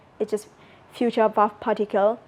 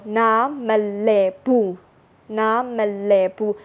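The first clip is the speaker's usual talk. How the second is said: 1 slow demo; slower than usual. Shown as a demonstration. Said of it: "So, you know".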